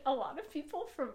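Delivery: normal talking voice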